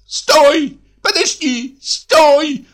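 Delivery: very high, piercing cry from behind; piercing tenor shout. A narrator's reading. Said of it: "раздался сзади троек пронзительный тенор". (пронзительный крик тенором)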